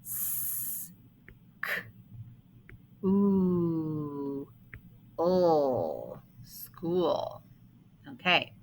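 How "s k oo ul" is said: "S, k, oo, ul" as separate sounds with pauses; sounding out. Shown as a demonstration. Teacher asking how many sounds in school, sounding out. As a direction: hiss the S, click the k, hold oo and ul. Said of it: "How many sounds?".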